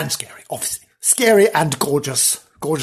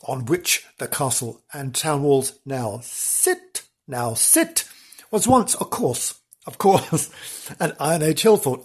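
usual speaking voice

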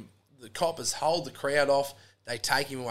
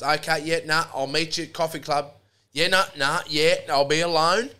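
louder, higher phone-chat voice; retold phone call; 3 semitones higher, 9 dB louder than usual. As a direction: play her loudly agreeing down the phone. Acting woman on the phone.

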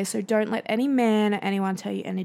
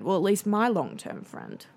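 normal talking voice

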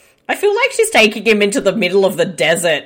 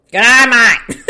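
loud, raised ocker Australian greeting; broad Aussie accent; 4 semitones higher, 7 dB louder than usual. Acted. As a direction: call it out in exaggerated Aussie. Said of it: "a family of Mick Taylors".

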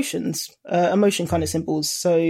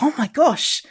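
higher-pitched gushing, retold; super excited; 6 semitones higher than usual. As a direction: relive being thrilled by a new treasure. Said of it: "super excited to be like".

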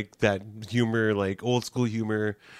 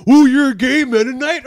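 high, loud jeering voice; mock jeering guy; 12 semitones higher, 13 dB louder than usual. Coworker jeering at the comedian, loud.

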